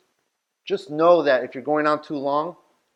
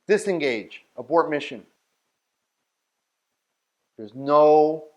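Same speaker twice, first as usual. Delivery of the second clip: normal talking voice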